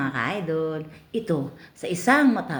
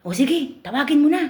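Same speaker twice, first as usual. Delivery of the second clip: high, louder eager voice; eager customer; 12 semitones higher, 5 dB louder than usual. Acted. Kuya Manong eagerly tells the pimp to call the girl, high and louder. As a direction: push him to hurry, keen.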